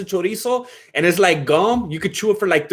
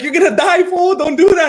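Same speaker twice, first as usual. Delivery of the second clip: higher, louder warning voice; mock alarmed manager; 13 semitones higher, 5 dB louder than usual. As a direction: manager warning him about raw bacon, mock alarm. Manager warning him about raw bacon.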